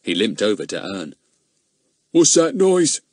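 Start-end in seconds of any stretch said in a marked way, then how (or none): 2.1-3.0 put-on high, louder hoarse old man voice. Old man asking Ern about the noise, hoarse. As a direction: croak it like a shabby old man. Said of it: "he said in his hoarse voice".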